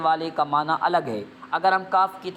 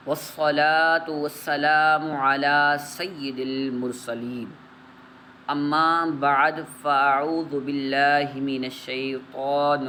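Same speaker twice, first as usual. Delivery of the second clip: slow, measured Quranic-style opening; Arabic recitation; 3 semitones lower, much slower than usual. Acted. Teacher opens the lesson with slow recitation. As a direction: recite the opening words slowly and solemnly.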